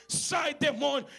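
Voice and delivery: slow, loud casting out; preacher yelling at a demon; shouted rebuke. Retold or imitated. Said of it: "Eu falei".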